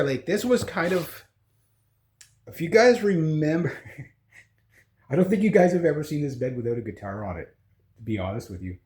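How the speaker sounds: ordinary talk, nothing marked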